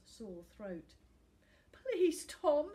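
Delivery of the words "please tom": high, loud desperate plea; pleading. A narrator's reading. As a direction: beg through tears. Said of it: "he pleaded".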